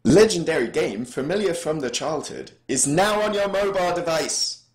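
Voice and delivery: high-pitched, over-emphatic app store pitch; all-caps ad read. Acted. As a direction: sell it in block capitals. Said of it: "the correct emphasis as is written here".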